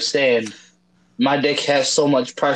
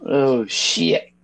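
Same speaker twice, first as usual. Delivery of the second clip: ordinary delivery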